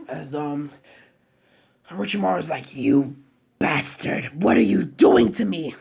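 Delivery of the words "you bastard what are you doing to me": louder, rising outrage; loud villain protest; furious villain. Acted. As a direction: spit it at the old man. Orochimaru being sealed.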